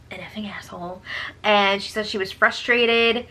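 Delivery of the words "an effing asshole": softer, hushed over the curse; whispered swear. Real, not acted. Telling viewers about the insult, whispered.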